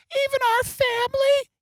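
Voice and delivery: put-on very high-pitched little kid voice; child's voice. Kid asking daddy about the family's fate, squeaky child voice. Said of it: "the kids would go".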